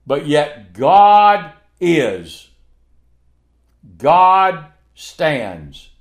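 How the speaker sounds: slow, weighty and firm; slow proclamation. Real, not acted. Preacher declaring God's permanence, slow and weighty. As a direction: lean on every word slowly.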